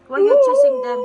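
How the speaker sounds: loud, high scolding call. Real, not acted. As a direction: call out sharply at the dog. Mother scolding dog at birds.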